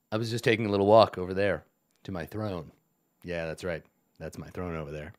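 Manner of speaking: low, resonant villain baritone; a hint of a deep goblin king. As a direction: roll it out low like a smug lord. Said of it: "resonant baritone voice".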